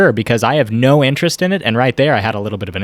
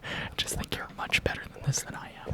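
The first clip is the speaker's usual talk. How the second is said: whispering; 18 dB quieter than usual. Pretend. Whispering during ASMR bit.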